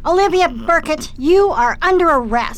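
loud, firm arrest call. Acted. Droid partner arrests Olivia, loud and firm. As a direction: announce the arrest with force.

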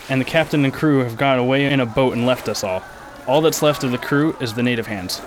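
usual speaking voice

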